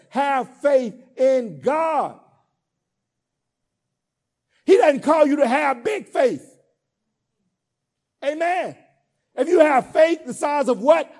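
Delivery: building call-and-response preaching; full-force preaching. Acted. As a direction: preach it to the congregation.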